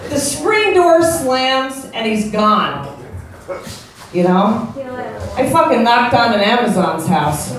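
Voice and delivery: normal talking voice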